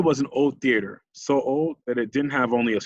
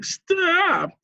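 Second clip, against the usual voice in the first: "Stop!" squeaks up much higher as the voice breaks; voice cracking. A narrator's reading. Nick's shout breaking, a cracked squeaky "Stop!". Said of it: "His voice cracked so much".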